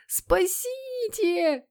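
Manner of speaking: very high, long-drawn squeal; squeak. Acted. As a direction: squeak for help, stretching the vowel. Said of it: "пискнул Питер". (тонкий протяжный писк)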